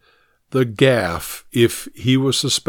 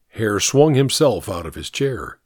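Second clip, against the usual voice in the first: ordinary delivery, nothing marked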